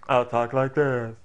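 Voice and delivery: put-on silly voice; funny voice. Shown as a demonstration. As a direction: switch to another goofy voice.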